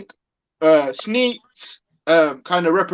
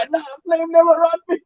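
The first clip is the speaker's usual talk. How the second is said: mock quote; 11 semitones higher than usual. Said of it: "I'm like".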